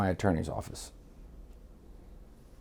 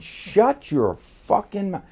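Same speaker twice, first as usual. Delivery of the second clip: high, slow, furious snap; angry retort; 11 semitones higher, 4 dB louder, much slower than usual. Retold or imitated. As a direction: spit it out slowly, shutting him up hard. Cutting off Mario at table.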